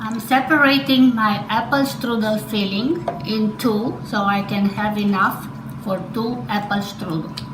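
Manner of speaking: normal talking voice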